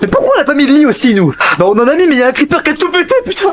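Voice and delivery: high-pitched panicky complaint; hammed-up high despair; dismayed whining. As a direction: wail about the disaster in the game. Bemoaning death and lost base.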